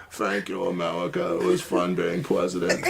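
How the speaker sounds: low, soft character voice; put-on comic voice. Thanking America after being president, low comic voice. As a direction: drop it low and quiet for a silly farewell.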